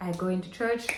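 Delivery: ordinary talk, nothing marked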